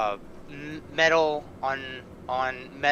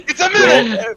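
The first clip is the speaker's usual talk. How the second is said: much higher and louder, laughing; excited laughing reveal; 11 semitones higher, 15 dB louder than usual. Real, not acted. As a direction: blurt the reveal, delighted. DM reveals cookie monster.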